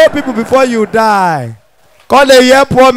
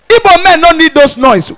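ordinary talk

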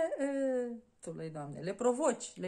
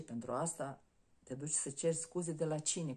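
normal talking voice

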